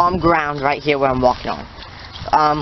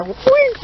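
playful, very high squeal of whee; high-pitched whee. Real, not acted. Vlogger playing on slippery ice, high whee. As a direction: let out a high little "whee".

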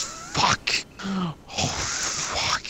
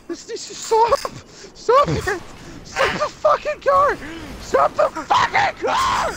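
desperate yells; 7 semitones higher than usual. Real, not acted. Begging his friend to stop the car, desperate yelling. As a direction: yell at him to stop, desperate.

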